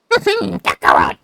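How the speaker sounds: slow, low, laughing villain drawl; Frieza voice. Acted. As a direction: chuckle the name smugly. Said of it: "Frieza voice is more like".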